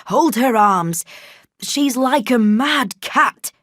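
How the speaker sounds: loud, slow urgent shout; struggling servant. A narrator's reading. Servant restraining Jane, loud and slow. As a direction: yell while holding her down.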